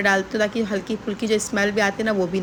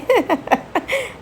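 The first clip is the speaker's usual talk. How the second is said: a bright squeaky giggle, high up; real giggle at her own cute joke; 12 semitones higher than usual. Real, not acted. Vlogger laughing at her own joke to viewers, high giggle.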